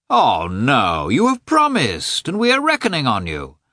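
high, swooping protest; dismayed protest. A narrator's reading. Oblonsky holding Karenin to his promise, dismayed protest. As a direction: plead high, refusing to accept it.

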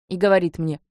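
ordinary delivery, nothing marked

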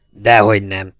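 a hint of boozy roughness. A narrator's reading. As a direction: answer like a seasoned drinker, lightly voiced. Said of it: "egy borizű hang".

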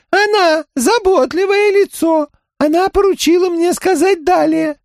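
very high monotone alien voice; high, flat and mechanical, like a crab creature. Acted.

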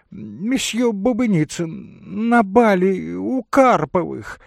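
high, loud, gushing socialite; chirping lady. Acted. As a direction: chatter brightly through the introductions. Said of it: "защебетала дама". (щебечет высоким дамским голосом)

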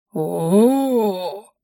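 long drawn-out "ooh" of amazement; stunned gasp. A narrator's reading. Friend first seeing the tea set, stunned sigh. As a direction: awestruck exhaled ooh. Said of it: "выдохнула ошеломлённая Арчиха".

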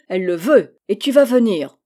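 loud, firm command. Acted. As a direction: lay down the law to the girl. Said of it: "Le ton ferme de Pierre".

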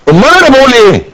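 indignant protest. Acted. Student insisting after correction.

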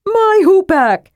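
high, drawn-out outraged yelp; affronted, high-pitched outburst; indignant cry. A narrator's reading. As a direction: protest at your property being taken. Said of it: "Tass cried indignantly".

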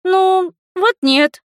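put-on high, hedging child's voice; voiced young girl. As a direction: answer as the kid, pitched high. (высокий детский голос)

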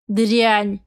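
a sharp furious hiss; hissed insult. A narrator's reading. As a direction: spit the word out in anger. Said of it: "прошипела мачеха".

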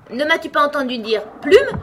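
loud, high angry question, rising at the end; furious. A narrator's reading. Sheep to Alice who ignored her call, furious. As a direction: cry it out indignantly. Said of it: "cria la brebis d'une voix furieuse".

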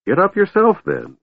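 very high, offended retort; hurt. A narrator's reading. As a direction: squeak it out wounded, dropping off at the end. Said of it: "hurt, backing up".

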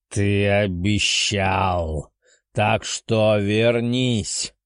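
slow growling dragon voice; drake. Acted. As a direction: growl the demand like a beast. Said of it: "прорычал дракон".

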